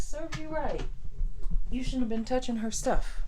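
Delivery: loud high teasing scold. Retold or imitated. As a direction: rub it in loudly. Teasing sister.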